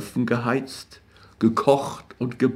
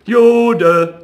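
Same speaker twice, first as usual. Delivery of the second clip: much higher, loud taunting cry; jeering call; 11 semitones higher, 7 dB louder than usual. A narrator's reading. Street boys chasing Rothschild, jeering loudly. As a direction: call it out after him. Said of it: "rannten mit dem Ruf".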